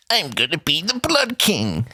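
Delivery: slow, high, strange voice; slow grandiose boast; villain declaration. Retold or imitated. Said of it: "And then he went".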